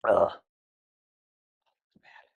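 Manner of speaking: normal talking voice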